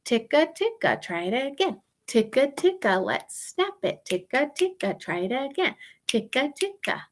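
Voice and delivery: quick soft ticka ticka in one beat; rhythm syllables. Shown as a demonstration. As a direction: model ticka ticka for the class to echo. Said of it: "Can you try that?".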